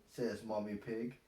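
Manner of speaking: ordinary delivery, nothing marked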